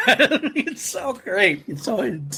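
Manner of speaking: high laugh spilling into the words. Real, not acted. Host delighting in Gimli's reaction, laughing. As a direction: laugh it out slowly.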